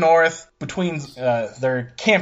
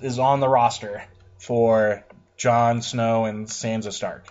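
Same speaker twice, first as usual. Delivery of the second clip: usual speaking voice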